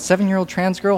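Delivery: high questioning double take; startled disbelief. Retold or imitated. Reacting to the headline, high startled disbelief. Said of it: "Was I the only one who went".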